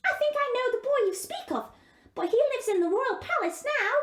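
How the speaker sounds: high, squawky bird voice with caws; a cheerful raven chattering; talking raven. Acted. Raven tells Gerda.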